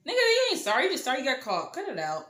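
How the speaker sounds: high, loud scoffing retort; scornful disbelief. Retold or imitated. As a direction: call out the fake apology, high-pitched. Scoffs at his apology.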